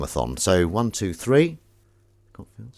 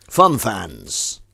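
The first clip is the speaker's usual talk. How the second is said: Fun fans! called out loud and bright; punchy catchphrase; 5 dB louder than usual. Shown as a demonstration. Washing-up vlogger calls out to viewers, loud and bright. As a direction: belt out Fun fans! like a show host.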